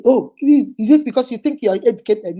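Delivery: loud, mocking accusation; loud mock taunt; mocking jealous boyfriend. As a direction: throw it back at her like a jealous man. Boyfriend's jealous retort, mocked.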